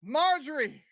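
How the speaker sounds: high-pitched mock yell; calling out. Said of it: "I walk out the door and I yell".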